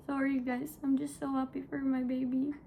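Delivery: normal talking voice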